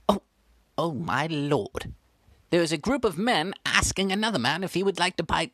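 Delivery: ordinary talk, nothing marked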